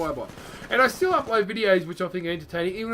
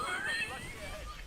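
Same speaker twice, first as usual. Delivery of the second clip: a sudden high-pitched giggle, quieter; high squeal of laughter; 13 dB quieter than usual. Real, not acted. Gamer cracking up as an enemy misses the Titan, high squeaky laugh.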